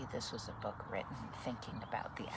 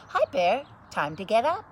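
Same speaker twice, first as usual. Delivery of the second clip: higher, louder friendly call; friends calling Bear; 9 semitones higher, 13 dB louder than usual. Acted. Animal friends calling into the cave, higher louder call. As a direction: wake the sleeping bear up. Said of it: "and said".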